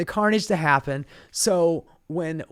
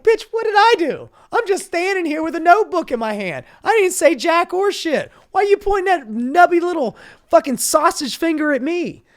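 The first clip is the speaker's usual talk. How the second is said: much higher and louder mock outrage; indignant reporter; 12 semitones higher, 7 dB louder than usual. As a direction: squeal it like an offended reporter. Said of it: "She's like".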